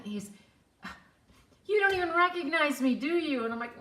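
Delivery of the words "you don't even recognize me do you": high, louder, quick teasing question; acted-out eager recognition; excited challenge. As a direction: playfully accuse someone of forgetting you. Stranger challenges her, retold.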